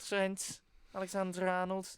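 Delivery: Trent Alexander-Arnold said slow and high in a Liverpool accent; Scouse accent. Shown as a demonstration. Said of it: "in the Scouse accent".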